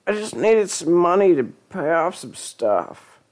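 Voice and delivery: ordinary delivery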